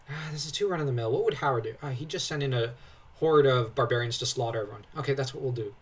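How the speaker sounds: normal talking voice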